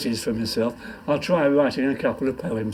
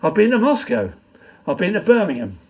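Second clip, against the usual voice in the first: loud, rhythmic poem reading; poem recitation; 4 semitones higher, 6 dB louder than usual. Acted. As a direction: declaim it to the pub crowd. Recites his comic poem.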